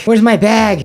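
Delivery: mock a mother's sudden panic; freaking out. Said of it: "then she starts freaking out".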